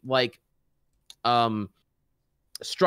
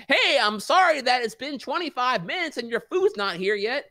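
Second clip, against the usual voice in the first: high, strained fake-polite server voice; sarcastic high-pitched apology to the customer; forced sweet server voice cracking into exasperation; mock server apology; 8 semitones higher than usual. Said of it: "Every fucking night was another".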